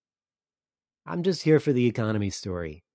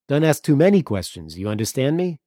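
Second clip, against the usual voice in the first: louder, pointed warning; 6 semitones higher, 8 dB louder than usual. Acted. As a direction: lean in hard on the warning. Antonis warns Thomas.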